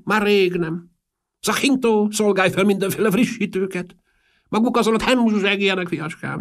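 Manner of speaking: put-on very high, loud old aristocrat voice; Marchese. A narrator's reading. Old host ordering refreshments for his guests, very high eccentric voice. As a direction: play a piping, high-pitched old eccentric.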